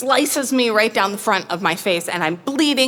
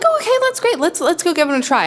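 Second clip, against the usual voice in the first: much higher, overly bright forced cheer; fake cheerful; 9 semitones higher, faster than usual. To the peacock owner, forced cheerful agreement. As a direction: chirp it out, hiding the doubt.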